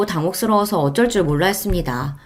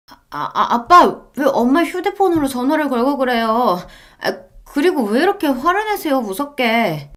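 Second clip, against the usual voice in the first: higher, flustered wife's voice; startled daughter; 6 semitones higher than usual. Acted. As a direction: stammer caught off guard. (당황한 딸의 높은 목소리)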